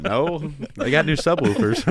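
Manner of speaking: mock onlooker's answer, high and loud; audience member replying. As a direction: imitate someone in the crowd explaining, amused. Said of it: "People are like".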